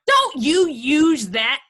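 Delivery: loud, high, drawn-out reprimand; angry scolding. Acted. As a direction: scold him word by word. Violent quarrel, mother scolds son.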